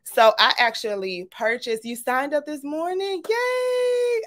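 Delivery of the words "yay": a squeal of yay, very high; excited yay. Real, not acted. Host cheering a viewer who signed up this morning, squealing high. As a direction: cheer like you just got great news.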